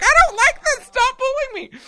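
very high squeaky complaining pup; whiny little dog voice. Retold or imitated. Tiny dog whining at her owner in a high squeak. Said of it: "her little face were like".

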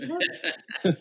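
high, baffled exclamation; incredulous yelp through a laugh; retold disbelief. Reacting to two months in London, retold astonishment. Retold shock at her answer.

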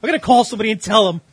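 mocking the caller's anger, loud and fast; mock mad whine. Host mocking caller, a loud mock-angry whine.

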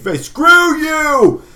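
high, slow furious yell at the police; enraged dad yelling from inside; screaming father. Acted. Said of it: "your father scream".

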